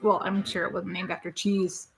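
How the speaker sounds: normal talking voice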